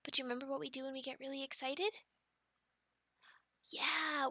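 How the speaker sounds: usual speaking voice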